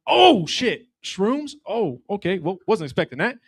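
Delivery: high, loud startled dad; dad hearing his son took shrooms; mock surprise. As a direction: act caught off guard, then curious. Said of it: "I'm like".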